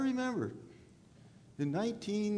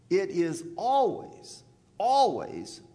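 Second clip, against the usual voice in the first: "always" said twice, louder and slower; stress on always; 5 dB louder, slower than usual. Shown as a demonstration. Preacher to congregation, leaning hard on "always". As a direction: lean hard on "always" both times. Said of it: "repeats always for emphasis".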